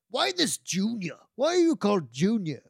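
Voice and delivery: put-on high Welsh-accented puzzled question; Gimli. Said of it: "In his best Welsh accent".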